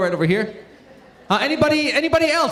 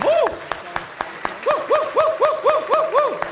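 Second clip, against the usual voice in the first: excited whoops. Real, not acted. Host leading applause for the elders, high excited whoops. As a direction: lead the cheering.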